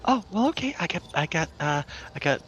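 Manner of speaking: high shopkeeper voice; helpful potion seller. Acted. Seller offering healing potions to customers, high helpful character voice. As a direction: count off stock in a high, lilting voice.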